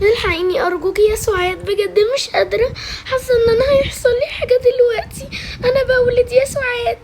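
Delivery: high, tearful labour plea; panicked pleading. Acted. A woman in labour begging her friend Suad, panicked and tearful. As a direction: beg for help, pitch climbing into a wail.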